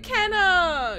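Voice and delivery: high, loud cry of a name; mock calling out. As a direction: call it out like an excited fan. Calls out a chat message.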